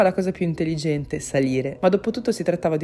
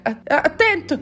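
high, stammered shout; very high "look out" yell; yelled warning; 11 semitones higher, 4 dB louder than usual. A narrator's reading. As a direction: stumble into an urgent warning cry. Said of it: "urlò".

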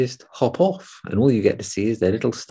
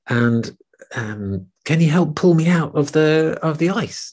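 higher pleading rabbit; higher rabbit plea; 7 semitones higher than usual. Acted. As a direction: beg for help as the little rabbit. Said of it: "Rabbit says".

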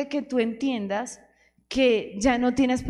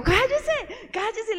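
much higher, shrill-pitched cry of "Cállese"; a high, exasperated mock yell at the executioner; mock shout; 10 semitones higher, much slower than usual. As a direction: shout a lady telling him to shut up. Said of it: "Una señora le gritó".

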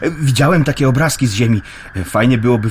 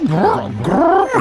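pigeon cooing; much slower than usual. Acted.

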